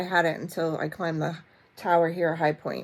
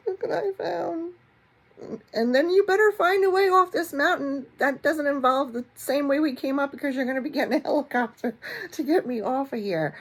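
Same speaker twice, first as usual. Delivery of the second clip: mock tearful wife; 8 semitones higher than usual. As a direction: play the panicked wife bossing him off the mountain. Retold tearful demand to husband.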